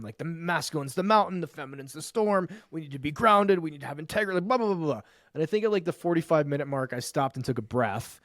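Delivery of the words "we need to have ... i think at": usual speaking voice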